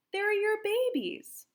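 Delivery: slow, high tender words. A narrator's reading. Tender realisation for Pauline.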